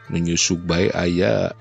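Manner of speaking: usual speaking voice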